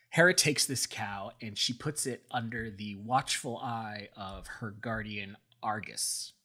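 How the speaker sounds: usual speaking voice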